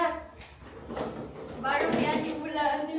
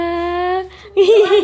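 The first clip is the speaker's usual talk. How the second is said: high, loud giggle; loud laugh; 5 semitones higher, 12 dB louder than usual. Real, not acted. Laughing after greeting Mamila.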